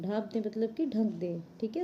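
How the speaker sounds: normal talking voice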